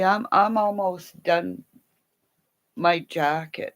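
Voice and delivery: ordinary talk, nothing marked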